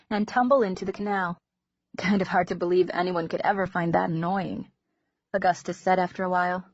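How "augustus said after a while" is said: usual speaking voice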